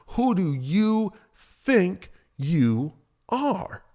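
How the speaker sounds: drawn out, slow and heavy; slow deliberate ask. Shown as a demonstration. Host testing ways to ask the question, slow. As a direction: stretch every word of the question. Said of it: "it really doesn't matter how I say it".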